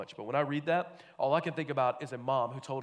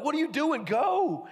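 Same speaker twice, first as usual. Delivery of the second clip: high, loud scolding push; exasperated urging; 12 semitones higher, 6 dB louder, slower than usual. Acted. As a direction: hustle the dawdlers along, loud and high. Messengers urging on gawking disciples.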